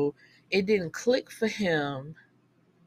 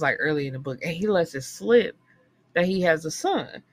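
ordinary delivery

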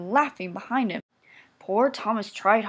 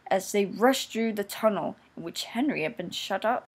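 ordinary delivery, nothing marked

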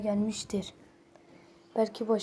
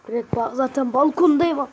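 loud; 2 semitones higher, 7 dB louder than usual. Acted. Yazılı cevabı sesli okuyor.

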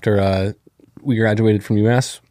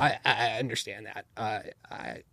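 put-on much softer, halting old-man voice with stammered repeats; RFK impression; 12 dB quieter than usual. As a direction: stammer it out softly like a frail old politician. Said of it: "is that your R F K impression?".

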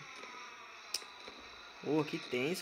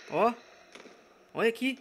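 ordinary talk, nothing marked

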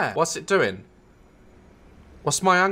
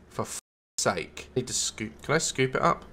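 usual speaking voice

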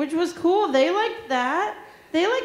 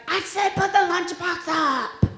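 slow, nagging mom voice, a touch higher; mock exasperated mother repeating herself; Momzilla scolding; 4 semitones higher, slower than usual. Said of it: "she's like".

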